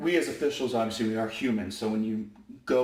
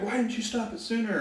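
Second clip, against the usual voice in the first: high, indignant mock coach whine; upset coach; 11 semitones higher than usual. Coach complaining to the referee, high mock whine. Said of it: "Coach is a little upset".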